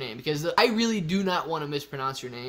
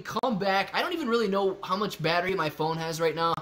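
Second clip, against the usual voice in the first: usual speaking voice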